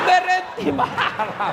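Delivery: laughing. Pretend. Comic punchline, laughing.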